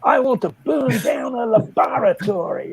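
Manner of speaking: mock-booming indiscreet declaration; loud voice. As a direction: imitate someone announcing it to the whole room. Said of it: "he said in a very l-, loud voice".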